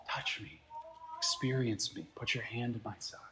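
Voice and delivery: whispered "Touch me", then soft; tender invitation. Pretend. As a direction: draw Thomas close, barely voiced. Jesus inviting Thomas to touch.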